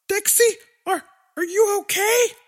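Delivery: high, worried little sister; young girl voice. Acted. Willix voiced, higher.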